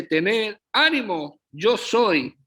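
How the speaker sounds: high, slow exclaimed cry across the storm; Jesus calling out to the frightened disciples, high and slow. Retold or imitated. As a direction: call it out slowly, like shouting to frightened men. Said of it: "de inmediato dice que él grita".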